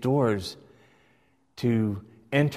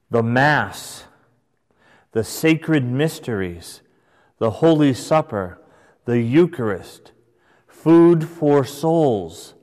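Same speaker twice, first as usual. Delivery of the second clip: louder, each title set apart and savoured; proclaiming a litany; 2 semitones higher, 6 dB louder than usual. Acted. Teacher reciting the sacrament's many titles to the class, louder and solemn. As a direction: announce the names like a solemn roll call.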